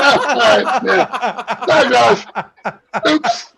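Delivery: high, laughing sheepish sorry; laughing apology. Retold or imitated. Referee recalls apologizing to Josh, laughing and high. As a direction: giggle through a guilty apology. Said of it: "I'm like".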